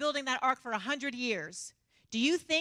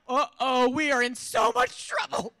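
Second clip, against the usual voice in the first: loud, slow, stretched-out dread; comic alarm; 4 semitones higher, 9 dB louder, slower than usual. Retold or imitated. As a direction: announce impending trouble, big and loud. Retold dread after husband's outburst.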